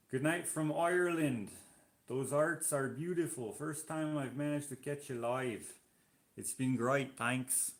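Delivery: put-on amateur Irish accent reading a chat message; attempted Irish accent. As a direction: have a go at an Irish lilt. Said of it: "that probably wasn't a good Irish accent".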